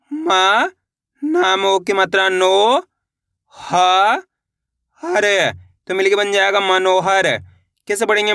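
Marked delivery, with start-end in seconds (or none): 0.1-5.3 isolated sounds with long pauses, "नो" drawn out; syllable-by-syllable spelling. Shown as a demonstration. Teacher leading learners to repeat along, slowly spelled out. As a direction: sound out "मा", "नो", "ह", "हर" separately. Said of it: "मेरे साथ साथ इनको repeat करना है".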